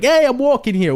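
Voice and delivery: loud, high mock New Yorker yell; mock New York accent. Making fun of New York people, loud New York accent. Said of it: "make fun of New York people".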